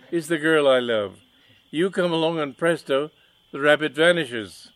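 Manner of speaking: loud, high, indignant villain; mustache twirling villain. Acted. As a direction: rant at the rival, outraged. Villain explains with props.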